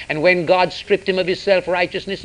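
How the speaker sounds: usual speaking voice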